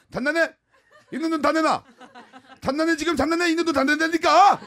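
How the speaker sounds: 단나네 and 있는 돈 다 낸다니까 lisped, shouted high; lisping shout. Retold or imitated. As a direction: yell it with a childish lisp. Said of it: "혀 짧은 소리로".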